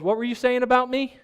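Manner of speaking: high, loud, rising question; nosy kid demanding. Retold or imitated. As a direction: imitate a touchy eavesdropper butting in. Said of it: "and then he goes".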